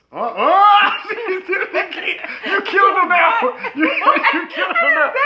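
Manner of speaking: high, loud laughing shout. Real, not acted. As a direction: howl with laughter. Wife's sound makes him erupt.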